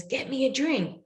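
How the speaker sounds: imitating a demanding son; demanding child. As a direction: snap it like a bossy kid.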